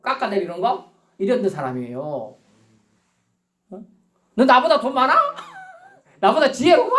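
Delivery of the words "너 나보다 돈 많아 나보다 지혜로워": high-pitched, hammed-up scornful challenge; mock contempt. Preacher mimicking someone who used to look down on people, mock scornful. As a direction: sneer at them like a show-off, played for laughs. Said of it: "막 사람 무시하던 사람이에요".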